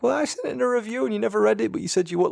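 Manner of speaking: high, quick put-on protest; complaining listener. Imagined listener griping about an unread review, mock complaint. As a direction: imitate an aggrieved listener in a high voice. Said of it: "and be like".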